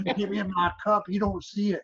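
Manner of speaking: high mock Southern referee voice. Referee scolding the fighter about his cup, high mock Southern voice. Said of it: "'cause he's like".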